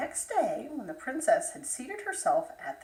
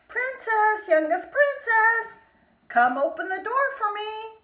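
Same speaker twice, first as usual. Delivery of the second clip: high, loud frog calling through the door; 8 semitones higher, 7 dB louder than usual. Acted. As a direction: call up to her, insistent. Frog knocks again.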